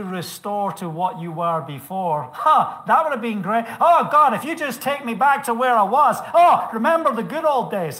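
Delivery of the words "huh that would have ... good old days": loud, high, hammed-up wishing; mock nostalgic pleading. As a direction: exclaim like someone longing for the past. Preacher acts out longing for past.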